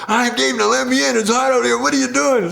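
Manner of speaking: high, loud desperate whine; frantic yelling. Retold or imitated. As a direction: bang and beg at the door. Dave yelling at door.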